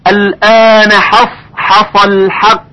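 حصل حق slowly with a light ح; slow careful recitation. Shown as a demonstration. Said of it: "حروف مرققه".

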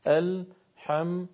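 Alhamdulillah split into slow, measured beats; syllable-by-syllable recitation. Shown as a demonstration. Teacher shows how beats should sound, slow syllabic recitation. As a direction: draw out the long vowel evenly. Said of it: "باید این شکل بشه".

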